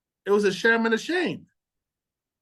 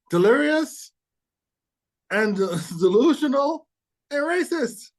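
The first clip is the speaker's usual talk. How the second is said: mock shocked; 4 semitones higher than usual. Real, not acted.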